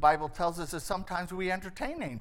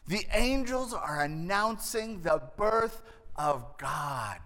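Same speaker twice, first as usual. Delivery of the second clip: ordinary talk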